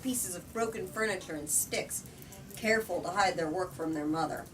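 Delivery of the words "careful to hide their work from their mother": ordinary delivery, nothing marked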